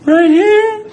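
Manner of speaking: very high, loud, slow panic; hammed-up panicked warning. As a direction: squeal it like a bear is right there. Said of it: "It was like".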